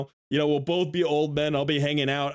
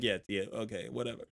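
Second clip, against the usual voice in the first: low, much softer brush-off; dismissive; 5 semitones lower, 10 dB quieter than usual. Retold or imitated. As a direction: wave him off under your breath. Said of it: "somebody's like".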